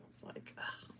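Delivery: rueful whisper; wistful hushed aside. Real, not acted. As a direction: whisper the regret to yourself. Regretful aside about spending.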